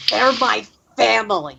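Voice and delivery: snarling. Acted. Leilani hears pure specimens and snaps back, snarling. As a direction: snarl it protectively.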